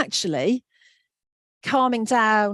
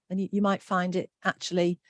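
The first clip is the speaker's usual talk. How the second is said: usual speaking voice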